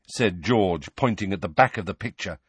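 ordinary delivery, nothing marked

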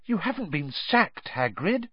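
high, shocked question; appalled disbelief in a high voice; gasped. A narrator's reading. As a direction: gasp it in alarm, pitched right up. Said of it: "gasped Hermione".

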